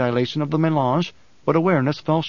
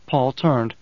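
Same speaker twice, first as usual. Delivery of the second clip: ordinary delivery, nothing marked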